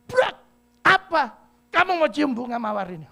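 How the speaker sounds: loud, high furious snap; angry outburst. Retold or imitated. Angry girl shouting at the young man who wants the rose. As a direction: slam the table and yell at him. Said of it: "langsung ngamuk".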